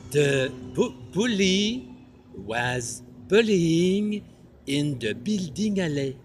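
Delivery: hammed-up Paris French accent on every word. Performing the tongue twister for the judge in a French accent. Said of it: "With a French accent?".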